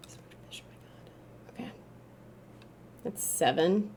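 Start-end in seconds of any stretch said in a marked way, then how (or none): 0.1-1.7 hushed awed whisper; unvoiced whisper of delight. Real, not acted. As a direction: breathe it out in secret amazement. Sneaking, awed at fish.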